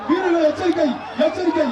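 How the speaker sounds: ordinary talk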